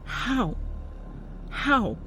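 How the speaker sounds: high, tearful disbelief; girl crying into a shoulder; sniffling. A narrator's reading. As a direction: ask through sniffles. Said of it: "Emily sniffles out".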